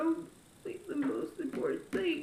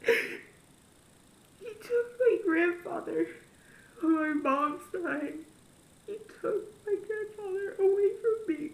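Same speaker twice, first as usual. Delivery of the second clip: slow, breaking sobbing voice; crying; 3 semitones higher than usual. Real, not acted. Telling viewers about losing her grandfather, crying. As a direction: drag the words out through tears.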